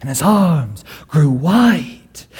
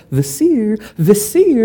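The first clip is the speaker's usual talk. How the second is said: higher playful call; 8 semitones higher than usual. Acted. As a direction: tease him by calling out. Spider Caliph calls the vizier.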